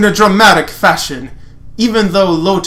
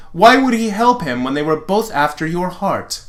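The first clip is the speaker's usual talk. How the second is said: ordinary talk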